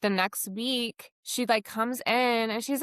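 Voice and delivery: usual speaking voice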